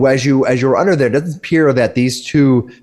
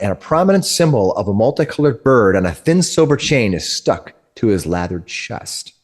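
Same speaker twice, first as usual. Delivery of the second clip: ordinary delivery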